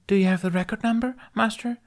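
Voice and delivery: put-on high, fast little girl voice; young girl's voice. A narrator's reading. Youngling asks the visiting master politely, high childlike voice. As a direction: voice her as a small eager child.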